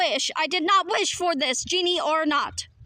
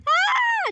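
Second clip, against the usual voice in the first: very high, loud shriek; shocked shriek; 5 dB louder than usual. Acted. Partner thinking the robot was deleted, shocked shriek. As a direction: shriek in alarm.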